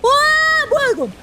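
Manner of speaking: startled shout. Real, not acted. Sudden scare in horror game.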